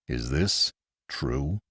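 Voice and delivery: low, soft and very slow; hardly able to believe it; stunned disbelief. A narrator's reading. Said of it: "perplexity and apprehension".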